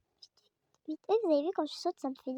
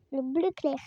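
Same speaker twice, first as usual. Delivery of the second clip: ordinary talk, nothing marked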